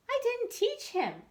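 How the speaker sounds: put-on high, innocent boy's voice. Peter answering Pam about his cat, high boyish voice. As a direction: answer like a surprised little boy.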